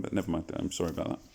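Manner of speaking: ordinary delivery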